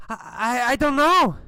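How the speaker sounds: put-on high, stammering nervous girl; anxious, high-pitched stuttering reply. A narrator's reading. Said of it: "The girl's very nervous".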